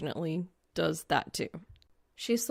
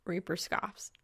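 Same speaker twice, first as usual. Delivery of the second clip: ordinary delivery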